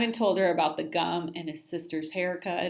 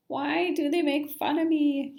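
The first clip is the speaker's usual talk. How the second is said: mild upset yell; 9 semitones higher, 3 dB louder than usual. A narrator's reading. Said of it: "he yelled".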